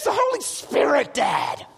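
put-on high-pitched boy explaining to Dad; mock kid excitement; excited son. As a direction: imitate an insistent, amazed kid. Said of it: "He goes".

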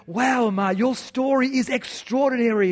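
high-pitched mock host amazement; American host. American host to young Algerian on stage, gushing. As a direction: gush like a host, accent left out. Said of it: "in his big Texan voice that I won't do".

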